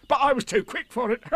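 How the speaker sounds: very high, boastful tiger voice; Tigger. A narrator's reading. Tigger boasting about escaping the tablecloth, high and excitable. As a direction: boast as a bouncy tiger, ending in a laugh. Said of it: "said Tigger".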